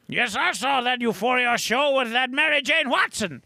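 high-pitched mock outrage; lightly hammed-up prudish scandal, pitched high; scandalised character voice. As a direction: play scandalised gossip. Riffing as a scandalised fancy character.

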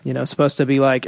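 ordinary talk, nothing marked

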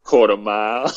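put-on Australians' version of an American accent; imitated American accent. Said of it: "They say".

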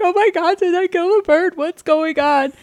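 very high, panicky and pleading; retold panic. Wife reliving the fear she hurt the bird, squealing high. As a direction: squeal it like you fear you killed it.

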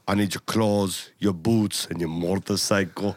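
low, slow Terminator impression; measured Austrian-accented menace; Arnold. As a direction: intone it deep like an Austrian cyborg. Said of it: "this is what we gotta say".

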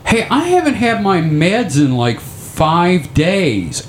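sudden realization. Retold or imitated. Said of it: "I thought".

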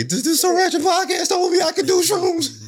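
high, slow mocking voice of a caught listener; mock podcast fan. Host mocks a listener blaming the podcast, in a high slow voice. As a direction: parody the listener blaming the show. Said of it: "and you say".

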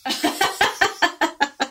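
high laugh; real laugh. Real, not acted. Laughs at missing him.

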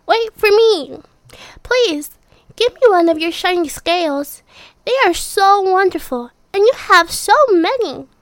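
high, loud pleading little voice; pleading little fish. Acted. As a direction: beg sweetly for a scale. Little fish begs for scale.

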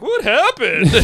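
high-pitched imitation of a clueless friend; mock bewildered question. Guy in the back after a crash, mock bewildered. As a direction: ask it high, in comic bewilderment.

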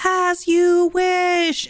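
high, very slowly drawn-out mock yell. Wesley yelling at Buttercup from down the hill, drawn-out mock yell. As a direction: stretch it out, hammed-up and high. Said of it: "then he yells".

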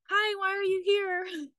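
high-pitched mock receptionist greeting; imitated greeter. Imitating the triage lady greeting a patient, high-pitched. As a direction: lift it high like a chirpy front desk.

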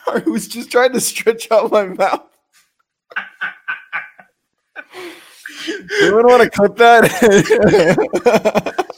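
talking through giggles; laughing, barely holding it. Real, not acted. Still laughing.

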